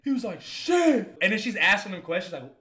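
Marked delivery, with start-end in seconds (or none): 0.4-1.2 high-pitched mock outcry; demanding patient. Injured roommate rebuffed by the nurse, imitated high outcry. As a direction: imitate a hurt man's frustrated yelp. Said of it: "he was giving her a hard time".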